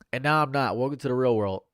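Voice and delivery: ordinary delivery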